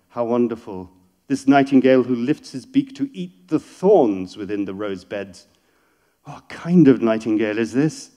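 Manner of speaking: loud, ardent declamation; poetry reading. Acted. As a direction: exclaim the lover's wonder. Reads the verse aloud.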